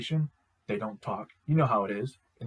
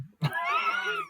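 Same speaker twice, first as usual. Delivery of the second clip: high burst of laughter. Real, not acted. Fan laughing at Cypher's reply, a high burst. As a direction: crack up at it. Amused at the scene.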